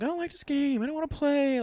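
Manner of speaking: high-pitched mock whine; whining. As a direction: imitate a kid whining about having to play. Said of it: "kinda, like, whining to me".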